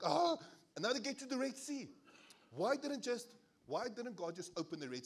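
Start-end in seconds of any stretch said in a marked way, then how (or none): 0.0-0.4 high loud mock yelp of a child dragged along; child's yelp. A child pulled by an upset mum yelps, mock.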